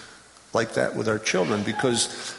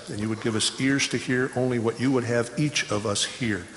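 lower, softer praying voice; prayer; 5 semitones lower, 4 dB quieter than usual. Real, not acted. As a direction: pray low and soft to God. Leads a prayer.

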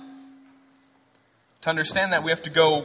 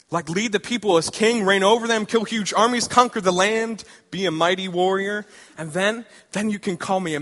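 excited Hosea; 3 semitones higher than usual. Acted. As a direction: pitch the big dream to God excitedly. Hosea dreaming of kingship.

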